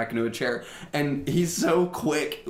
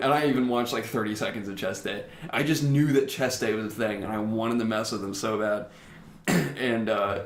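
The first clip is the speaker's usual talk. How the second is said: ordinary talk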